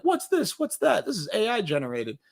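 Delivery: high, scoffing mock questions; mocking nitpicker. Imitating Mike Deere picking at the movie, high scoffing mockery. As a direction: sneer at every little thing. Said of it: "He was like".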